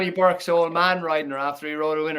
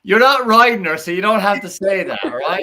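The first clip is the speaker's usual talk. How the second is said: teasing call-out; 5 semitones higher, 7 dB louder than usual. Real, not acted. Teasing the jockey pundit.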